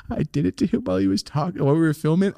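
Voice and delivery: ordinary talk, nothing marked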